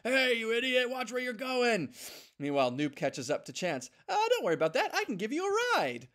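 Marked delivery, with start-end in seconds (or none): 0.0-1.9 annoyed ox god. Acted. Ox god on the beach scolding Noob, angry shout. 4.1-6.1 much higher, chirpy and keen; eager little dog. Acted. Noob offering Chance a lift, high eager voice.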